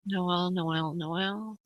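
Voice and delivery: repeating the name softly to herself; murmured name. Real, not acted. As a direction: murmur it, tuning in. Medium tuning into caller's energy.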